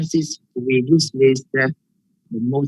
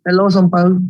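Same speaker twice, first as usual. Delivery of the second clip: raised, louder call to a classmate; higher, louder hail; 5 semitones higher, 6 dB louder, faster than usual. Real, not acted. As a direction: call out to get someone's attention. Calling an unresponsive classmate.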